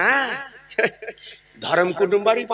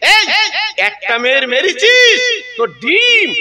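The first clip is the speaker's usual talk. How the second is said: higher and louder, slowed, মেরেছিস hammered; louder, slower accusation; 11 semitones higher, 4 dB louder, slower than usual. Retold or imitated. Preacher voicing an angry father-in-law threatening the son-in-law, raised slow accusation.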